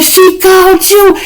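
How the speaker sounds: very high, slow, squealing outrage; mock angry mother. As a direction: screech it back like the drunk mom.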